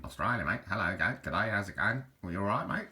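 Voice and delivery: jokey Australian accent, lightly put on; Australian accent, friendly g'day greeting; mock Australian accent. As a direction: play a cheery Aussie bloke in an Australian accent. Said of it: "held by an Australian".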